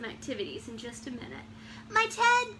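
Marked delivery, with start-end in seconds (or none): 1.9-2.6 high, loud startled exclamation; storybook gasp. A narrator's reading. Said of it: "gasped the bear".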